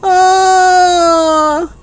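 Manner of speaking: very long, high despairing wail. A narrator's reading. Heartbroken teacher outside the classroom, long drawn-out wail. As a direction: hold a high wail until breath fades. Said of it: "an even longer one".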